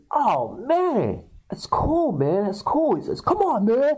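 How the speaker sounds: high, whiny mock pleading; high-pitched hammed-up protest; punched guy protesting. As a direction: imitate a guy backing off after a punch. Voices the punched man.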